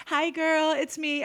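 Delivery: sweetly innocent hello; chirpy playful greeting; mock cheery greeting. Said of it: "I'm like".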